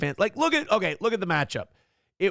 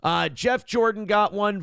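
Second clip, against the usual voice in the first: usual speaking voice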